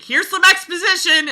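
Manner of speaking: high, loud, cheery announcement; a hammed-up principal dumping info; mock principal. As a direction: sing it out like a principal barging in. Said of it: "should have just come in and been like".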